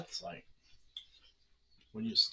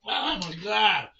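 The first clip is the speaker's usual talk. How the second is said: shocked exclamation; 6 semitones higher, 10 dB louder than usual. Real, not acted. Second sour candy.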